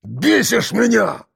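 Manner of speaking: slow, high-pitched angry outburst; acted furious bark; roared fury. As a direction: spit out exasperation, each word drawn out. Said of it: "рявкнув так, что барак дрогнул".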